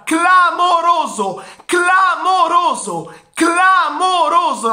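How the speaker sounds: high, slow, drawn-out cry; ecstatic shout. Real, not acted. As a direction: bellow it like a goal call. Stunned goal exclamation, high slow.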